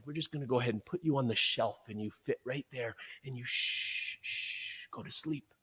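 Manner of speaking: mock lullaby hush, fading to a whisper; hushing to sleep. The world hushing you to sleep.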